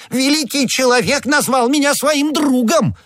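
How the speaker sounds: very high, hammed-up squeal of rapture; ecstatic outcry. A narrator's reading. Shopkeeper thrilled a great man called him friend, ecstatic squeal. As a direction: exult in a piercingly high voice. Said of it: "вскричал Бонасье".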